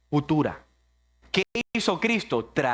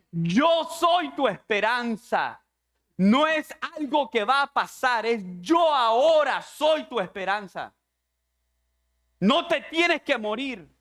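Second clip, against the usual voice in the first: Jesus to Martha; 6 semitones higher than usual. Acted.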